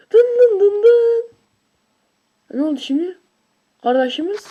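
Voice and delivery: normal talking voice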